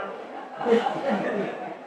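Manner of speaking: soft unvoiced laugh falling away; breathy falling laugh. Real, not acted. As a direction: let a quiet laugh slip out. Laughing after cat's meow.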